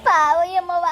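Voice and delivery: low mournful wail; hammed-up low sorrow; acted lament.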